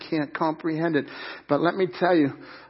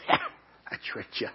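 sly taunt; 3 semitones lower, 7 dB quieter, much slower than usual. Pretend. Cruel dad voiced in story.

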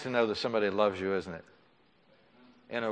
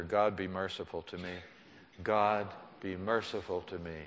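prayer; 2 semitones lower, 5 dB quieter than usual. Shown as a demonstration. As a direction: lead the people in a quiet prayer. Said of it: "Let's say it together".

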